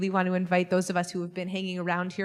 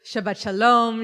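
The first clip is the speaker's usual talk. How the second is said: a big, louder Shabbat greeting to the room; 2 semitones higher, 9 dB louder than usual. Real, not acted. Rabbi greeting the congregation at the start, louder. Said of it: "Let's try one more time".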